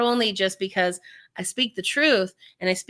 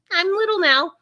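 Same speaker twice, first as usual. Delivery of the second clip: put-on little kid voice; 9 semitones higher than usual. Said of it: "You get this little kid voice".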